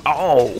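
sudden high, loud "oh"; startled yelp. Real, not acted. Player hit in the game, startled yelp.

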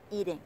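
EA of "Eating" long and strong, -ting weak; first-syllable stress. Shown as a demonstration. Teacher showing word stress, first syllable of Eating drawn out. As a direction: stretch the "ee" in Eating. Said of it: "chỉ có một âm ỉ được nhấn".

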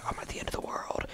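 hushed mock-dramatic whisper. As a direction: breathe it out like a secret. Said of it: "and then I could be like".

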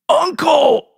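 high strained cry of surrender; croaked plea. A narrator's reading. Beaten trucker croaking surrender to his attacker. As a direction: beg for mercy in a cracked cry. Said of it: "he croaked".

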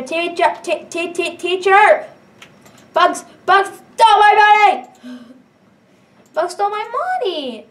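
high, loud tattletale whine; tattling kid. Acted. Kid tattling to the teacher that Bugs stole money. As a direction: cry out to the teacher like a wronged kid.

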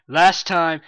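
ordinary delivery

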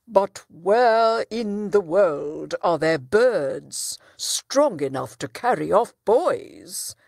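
a hint of stern judge; doubting judge.

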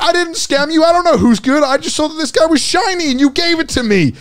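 very high-pitched mock self-defence; hammed-up squeaky protest. Acted argument with crying kid.

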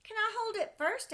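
put-on high, loud pleading child voice; eager little boy. Sam begging his dad to hold the kite, high eager child voice. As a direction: beg like a small kid who wants a turn. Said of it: "asked Sam".

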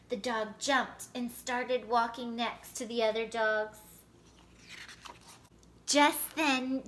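ordinary delivery, nothing marked

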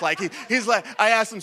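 ordinary talk, nothing marked

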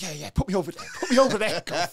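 high, loud excited insistence; telling the gov to put him on that wing; eager agreement. Retold or imitated. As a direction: jump at the chance.

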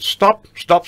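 high, loud call of stop, stop; retold shout. Director halting rehearsal to call everyone over, shouting stop. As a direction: bark stop, stop to halt the whole company.

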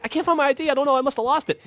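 high-pitched flustered excuse; mock panic. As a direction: retell it in a high, helpless fluster. Retold panic over lost ID.

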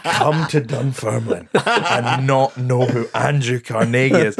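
slow, indignant, breaking into laughter; imitating the offended woman while laughing; mock indignation. Comic mimicking an annoyed audience member, laughing. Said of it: "she looked so fucking annoyed at me".

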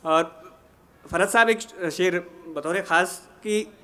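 ordinary talk

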